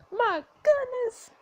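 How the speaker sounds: slow, very high-pitched coo; high, smitten exclamation; gushing delight. Acted. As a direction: gush in a high drawn-out voice. Gushing over the cute robot.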